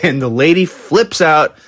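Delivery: ordinary delivery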